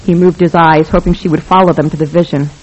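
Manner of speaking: ordinary delivery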